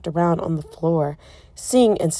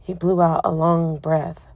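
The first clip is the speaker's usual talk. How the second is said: ordinary delivery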